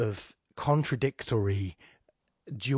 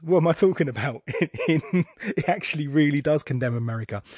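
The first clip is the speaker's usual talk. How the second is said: fast, louder, laughing self-correction; laughing at himself; 3 semitones higher, 5 dB louder than usual. Real, not acted. Correcting himself mid-thought, laughing. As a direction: catch yourself out and laugh. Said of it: "actually it does".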